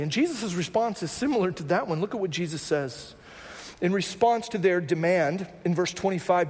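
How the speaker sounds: ordinary talk, nothing marked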